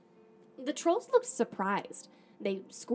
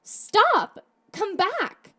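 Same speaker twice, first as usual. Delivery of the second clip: high, urgent yell; 10 semitones higher, 6 dB louder, much slower than usual. A narrator's reading. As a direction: call after a runaway, pitched right up. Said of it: "so I yelled".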